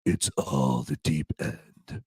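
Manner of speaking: deep, slow and quiet Dredd impression; Judge Dredd voice. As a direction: drop low and slow like a stern judge. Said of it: "And Judge Dredd's like".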